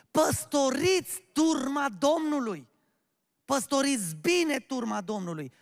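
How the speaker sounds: slow, loud, high exhortation; forceful preaching. Real, not acted. Preacher charging the church to shepherd the flock, forceful preaching. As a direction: proclaim it as a command.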